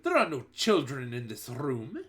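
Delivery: plain reading voice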